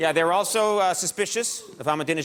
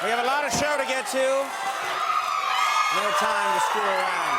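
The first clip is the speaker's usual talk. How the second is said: shouting over the crowd; 5 semitones higher than usual. Real, not acted. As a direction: raise your voice over the cheering. Host shouting over cheering crowd.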